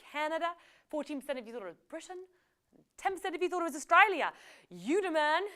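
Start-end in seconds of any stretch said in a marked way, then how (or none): 4.7-5.4 punchy, louder praise; excited praise. Real, not acted. Quiz host cheering a correct player, excited. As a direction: hype the winner up. Congratulating a player who got it right.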